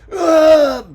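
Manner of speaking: very loud, high angry roar; bellowed grunt. A narrator's reading. Ricardo bellowing at his son, angry roar. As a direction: bellow him off. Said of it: "bellowed Ricardo".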